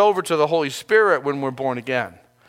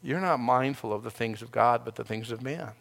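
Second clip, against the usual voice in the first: quiet stern rebuke; lightly voiced stern God, dropping softer; a hint of a firm rebuke, softly; voice of God rebuking; 5 dB quieter than usual. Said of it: "And God would be saying".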